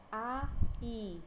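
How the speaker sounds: "aí" as two distinct vowels, a, then í; split into syllables. Shown as a demonstration. Teacher showing a two-syllable word, "aí" split apart. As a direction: separate "aí" into a... í. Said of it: "Quando a gente vai separar".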